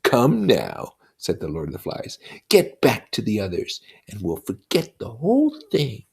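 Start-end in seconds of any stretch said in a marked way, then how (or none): 0.0-1.0 slow, higher, louder sneering demon voice; a buzzing devil taunting a child; Lord of the Flies, mocking. Lord of the Flies taunting Simon, slow and sneering. 2.5-6.0 put-on a buzzing devil taunting a child; Lord of the Flies, mocking.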